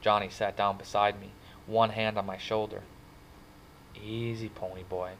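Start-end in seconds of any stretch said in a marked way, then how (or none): 4.0-5.1 gentle, hushed soothing; soft. A narrator's reading. Friend comforting crying kid on the curb, softly. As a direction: soothe him quietly. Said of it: "he said softly".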